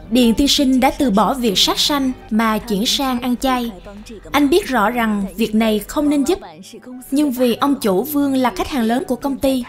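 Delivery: usual speaking voice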